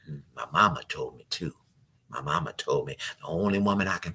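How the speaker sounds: low, hushed, brooding confession; mock-solemn, soft and low, nearly whispered in places; wounded mama's boy. Man confiding to his date about his mama, low and brooding.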